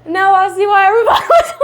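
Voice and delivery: can't get the words out for laughing; laughing out loud. Real, not acted. Patient to doctor, giddy after the adjustment, laughing.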